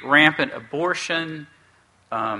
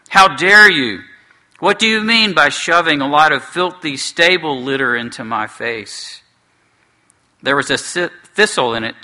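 angry dwarf; 4 dB louder than usual. Acted. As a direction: rage at the flowers shoved in his face. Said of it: "he shouted".

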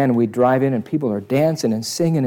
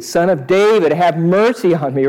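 beggar crying out louder; 5 semitones higher, 5 dB louder than usual. Acted. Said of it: "because he says".

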